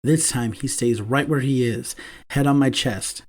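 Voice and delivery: usual speaking voice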